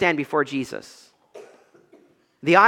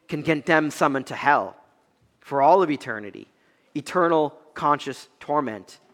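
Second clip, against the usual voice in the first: ordinary talk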